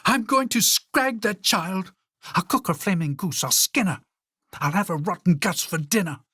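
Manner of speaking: the queen losing her mind with rage; furious yell. Acted. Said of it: "She yelled".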